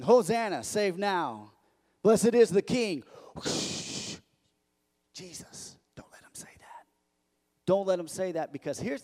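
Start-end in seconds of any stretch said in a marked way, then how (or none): 0.0-3.0 raised, mildly hammed-up cry of praise; crowd's acclaim. Crowd waving palms at Jesus, acted acclaim. 3.3-4.2 long, hissed "shhh"; urgent shushing. Acted. Nervous Pharisee silencing the praising crowd, shushing. 5.1-6.9 very soft whispered warning; urgent whisper. Acted. Nervous Pharisee privately warning Jesus about the crowd, whispered.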